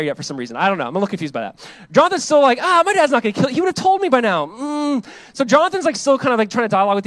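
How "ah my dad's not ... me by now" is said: high, louder casual brush-off; breezy dismissal. Pretend. Jonathan telling David his dad won't kill him, breezy and high. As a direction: laugh off a friend's fear, up high.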